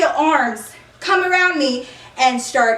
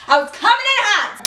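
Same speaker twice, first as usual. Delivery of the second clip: pitched-up, punchy repeat; excited emphasis; 8 semitones higher than usual. Real, not acted. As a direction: hit it higher, all worked up. Repeats line with rising excitement.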